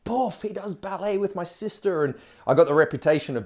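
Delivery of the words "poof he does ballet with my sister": high mocking schoolyard taunt; taunting bully. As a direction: sneer it like the year seven bully. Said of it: "this guy was basically saying".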